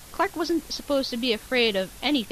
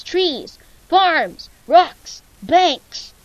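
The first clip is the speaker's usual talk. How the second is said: louder and higher with each word; building; 6 semitones higher, 12 dB louder, much slower than usual. A narrator's reading. Narrator lists what the claws smash, building loud. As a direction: pound each item harder.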